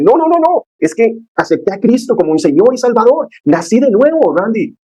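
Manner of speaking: high, excited burst of joy; bubbling over with new faith; overjoyed. Retold or imitated. New believer telling his friend he was born again, overjoyed. Joyful testimony retold.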